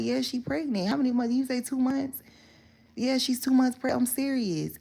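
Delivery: high-pitched acted back-and-forth; imitated insistent son. Son telling mom about the pregnancy, imitated insistence. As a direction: keep the retold insistence pitched well up.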